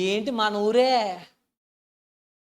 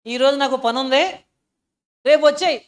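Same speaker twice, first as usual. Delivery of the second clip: mock careless prayer; 3 semitones higher, 7 dB louder than usual. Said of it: "అనుకుని చేస్తాం మనం".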